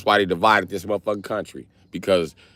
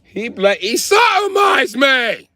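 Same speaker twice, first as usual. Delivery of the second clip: very high, loud, slow British wail; shrieking outrage drawn out; a hammed-up British shriek; 4 dB louder, much slower than usual. Brit character wailing complaint.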